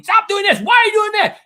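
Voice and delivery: high, loud angry shout; yelling at kids. Retold or imitated. Dad yelling at his kids for doing wrong, loud and high. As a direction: snap at the kids like a fed-up dad. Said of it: "I'll start yelling".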